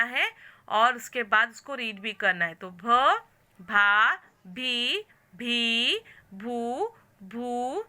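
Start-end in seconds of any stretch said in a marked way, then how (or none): 2.7-7.8 slow, spaced syllable-by-syllable reading; syllable drill. Shown as a demonstration. Teacher drilling Marathi syllables for the class, slow and clear. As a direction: call each sound out clearly for pupils to repeat.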